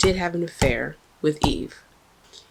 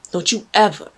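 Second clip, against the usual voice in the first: ordinary talk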